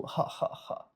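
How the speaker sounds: soft, breathy forced "ha, ha, ha"; half-hearted fake laugh, evenly spaced. Shown as a demonstration. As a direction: force out a quiet, deliberate laugh. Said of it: "do a fake laugh to yourself".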